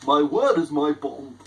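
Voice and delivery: Roger Moore. Acted. Homebrewer impersonating an actor while pitching yeast, terrible impression. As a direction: draw it out slowly in a hammy film-star voice. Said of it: "a really terrible Roger Moore impression".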